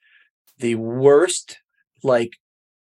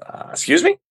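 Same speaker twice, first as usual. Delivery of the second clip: taken aback. Retold or imitated. Retold baffled reply to odd order.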